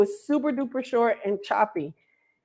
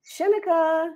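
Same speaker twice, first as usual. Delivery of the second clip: lightly voiced caller, higher; calling Shinika; 6 semitones higher than usual. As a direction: call out the wrong name lightly. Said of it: "I kept hearing".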